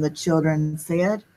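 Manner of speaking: usual speaking voice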